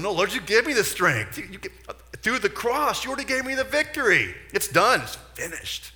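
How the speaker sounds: loud, high faith declaration to the Lord; bold proclamation. Retold or imitated. Preacher declaring victory to God, loud and high. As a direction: shout it like a victory cry.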